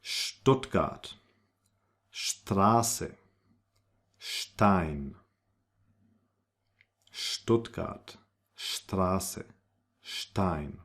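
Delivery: Stuttgart, Straße, Stein each start with scht; German st as scht. Shown as a demonstration. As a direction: hush the s into sh before t. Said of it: "pronounced like if there was a little H".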